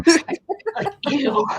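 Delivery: high, loud laughing ew; giggling real cringe; laughing disgust. Real, not acted. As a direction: squeal it out through laughter. Disgust at pet names, laughing.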